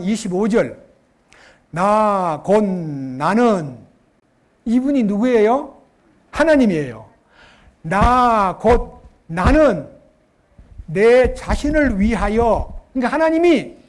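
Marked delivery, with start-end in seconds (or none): 1.7-3.8 each word drawn out, loud and weighty; solemn proclamation; slow emphatic scripture reading. Acted. 7.8-12.8 each word drawn out, loud and weighty; solemn proclamation; slow emphatic scripture reading. Acted.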